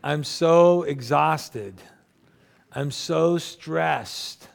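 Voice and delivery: slow, drawn-out mock moan; exhausted complaint. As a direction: stretch each "so" like a worn-out complainer. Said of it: "It always kind of sounds like this".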